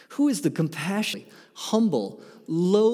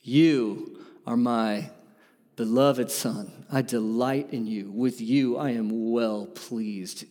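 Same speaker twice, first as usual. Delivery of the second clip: tender father; 4 semitones lower, slower than usual. Acted. The Father delights in his Son, slow tender voice. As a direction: speak it like a proud father, slowly.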